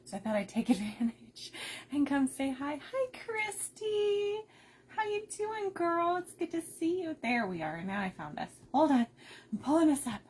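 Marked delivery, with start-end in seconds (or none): 2.9-7.2 high, slow, delighted hello; light up for a friend arriving; excited greeting. Real, not acted. Host welcoming a friend into her live stream, excitedly.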